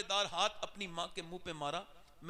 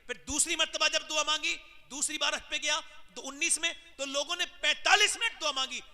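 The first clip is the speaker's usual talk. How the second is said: preaching shout; 7 semitones higher, 6 dB louder than usual. Real, not acted. Preacher builds to a climax.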